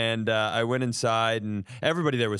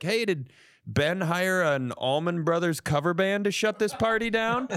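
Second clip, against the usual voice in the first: imagined partygoer mocking; 7 semitones higher than usual. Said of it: "I'm sure they're like".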